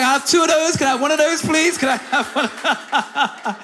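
thrilled guest ordering free mocktails, loud and laughing; excited pleading. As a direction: beg for another drink like a delighted kid. Gleefully ordering free drinks.

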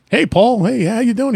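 mock-cheerful coworker calling out; cheery coworker greeting. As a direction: hail him like an eager colleague. Coworker greets dad on trolley.

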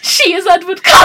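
high, loud excited cry; excited shout. Real, not acted. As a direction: shout it in delight.

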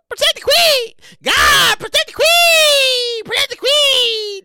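high, drawn-out wail; long, loud, high mock battle cry. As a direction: cry out in exaggerated devotion, stretching the words. Mock rallying cry for the Queen.

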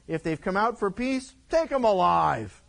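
lightly voiced boastful king giving orders; arrogant drunk king. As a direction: bark it out without a care. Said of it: "And he said".

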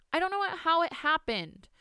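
put-on high sulky child voice; sulkily. Girl denying blame to grandmother, sulky high voice. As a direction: mumble it like a pouting child. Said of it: "the girl said sulkily".

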